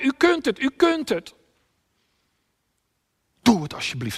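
high, loud repeated shout; crying out. Acted. Preacher as Jesus shouting to his Father, crying out. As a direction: shout it as a desperate appeal. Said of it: "Hij schreeuwt het uit".

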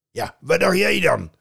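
loud troll retort. Acted. Troll answering Loki, loud. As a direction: scoff like a troll.

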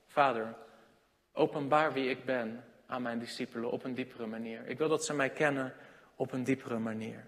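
soft, low prayer to the Father; quiet prayer. Acted. Jesus prays to his Father, soft and low. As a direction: pray it gently, barely above a murmur.

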